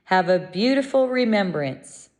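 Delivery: ordinary talk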